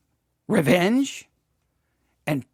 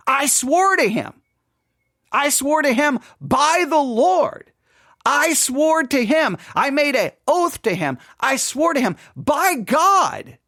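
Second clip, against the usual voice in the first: emphatic oath; 9 semitones higher, 4 dB louder, slower than usual. Acted. David's oath dramatized.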